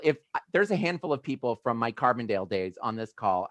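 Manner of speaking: ordinary delivery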